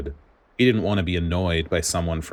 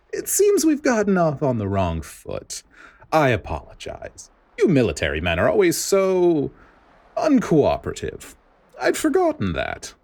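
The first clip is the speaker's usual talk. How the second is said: sugary scheming official; 10 semitones higher, 3 dB louder than usual. Acted. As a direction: purr an apology that is really a jab. Spandine's fake-pleasant voice.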